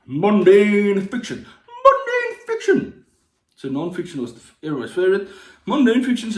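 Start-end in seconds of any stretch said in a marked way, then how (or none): 0.0-1.5 slow hyped announcement. Pretend. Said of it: "announcing the genre". 1.8-3.0 much higher, slow squeal of mundane; squeaky high mock announcement. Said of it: "repeats the title, high".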